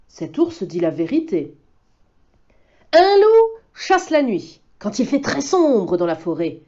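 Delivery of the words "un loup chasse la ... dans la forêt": spooky bear. Acted. Second bear warns about night hunting, spooky. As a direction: make the night sound scary. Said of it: "dit le deuxième ours".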